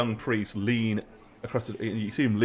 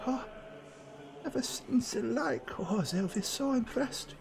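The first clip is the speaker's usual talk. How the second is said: high, soft, half-whispered awe to himself; marvelling under his breath at the carvings; awed whispered muttering; 11 semitones higher, 12 dB quieter than usual. Acted.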